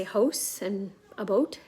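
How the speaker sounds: "house and a boat" with rounded o vowels; low, East Coast o in "house and a boat"; Canadian ou sound. Shown as a demonstration. East Coaster showing her vowels, rounded "house and a boat". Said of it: "I do say like that".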